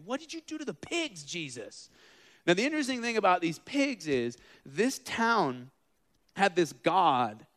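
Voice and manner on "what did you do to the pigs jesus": sharply higher, accusing question; a townsman's high indignant question. Retold or imitated. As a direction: pitch it up in shocked accusation. Townspeople confronting Jesus.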